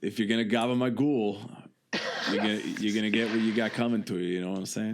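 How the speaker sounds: mock New York tough guy, quick; a hammed-up New York wiseguy accent; fake New York accent. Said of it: "That's my fake New York accent".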